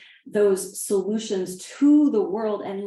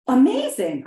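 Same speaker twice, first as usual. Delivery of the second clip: loud, higher burst of delight; excited reply; 4 semitones higher, 7 dB louder than usual. Acted. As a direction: exclaim it with mild delight. Man's delighted reply in story.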